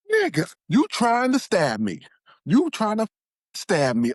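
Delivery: high, loud accusing yell at her son; imitating a mother's hysterical high yell; mock scream. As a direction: shriek the accusation, stretched out slowly. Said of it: "hears her screaming and yelling".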